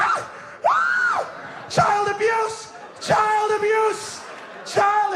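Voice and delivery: high, drawn-out mock scream for help; acted outcry. Slapped pupil crying child abuse, hammed-up high yell. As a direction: wail it slowly, way up high, hammed-up.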